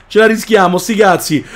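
ordinary talk

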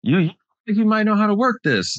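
put-on high, polite old-lady question; nice old lady. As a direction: lift it high like a little old lady asking for help. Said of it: "this nice old lady pulls up".

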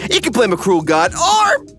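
ordinary talk

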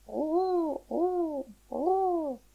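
long, high pigeon coos; drawn-out cooing, pitched high. A narrator's reading. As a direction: coo like a little flock of pigeons. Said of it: "un bruit de voix la réveilla".

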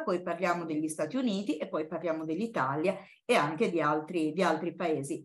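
usual speaking voice

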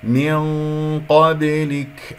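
من قبلك very slow, long hum, bounced ba; drawn-out recited من قبلك; tajweed demonstration. Shown as a demonstration. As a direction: hold the ghunna, then lightly bounce the b of قبلك. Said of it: "الباء مقلقلة قلقلة صغرى".

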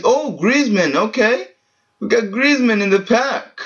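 high, bright thrill at a big pull; excited. Real, not acted. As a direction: let the pitch jump with joy. Good player pulled.

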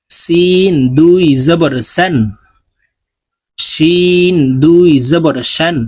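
slow sing-song letter drill, each line rising; tajweed recitation drill. Shown as a demonstration. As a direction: chant each letter for students to repeat. (ধীর সুরেলা তাজবিদ উচ্চারণ অনুশীলন)

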